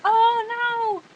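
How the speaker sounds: high, loud drawn-out wail; playful exaggerated oh no; mock dismay. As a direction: wail it in hammed-up despair. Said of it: "sometimes it fails".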